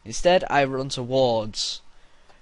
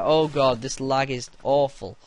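ordinary talk, nothing marked

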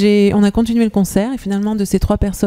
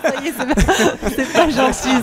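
laughing through the words; 4 semitones higher than usual. Real, not acted. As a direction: giggle while speaking. Laughing through stage fright.

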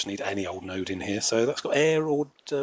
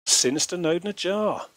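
higher, gloating villain announcement; unhinged villain; 7 semitones higher, 3 dB louder than usual. Acted. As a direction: savour each word like a mad villain. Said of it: "That's my evil laugh there".

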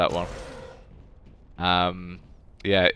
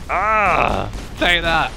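loud, high cry of triumph; triumphant shout; 7 semitones higher, 5 dB louder, much slower than usual. Real, not acted. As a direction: shout it like landing a hit.

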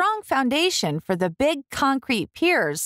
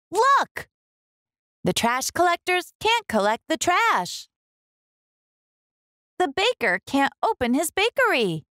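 higher, urgent officer explaining; excited police officer; 5 semitones higher than usual. Acted. Police officer telling the mayor about the jam, urgent. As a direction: point it out with alarm. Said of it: "she replies".

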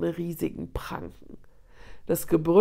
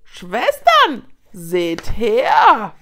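rallying shout; 2 semitones higher, 9 dB louder, much slower than usual. A narrator's reading. Hyena leader calls her sisters to look, loud shout. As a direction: shout out the alarm.

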